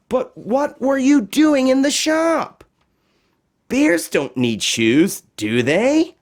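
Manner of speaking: put-on high, small voice of a worried girl; Josephine despairing. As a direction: sound defeated at the foot of a mountain.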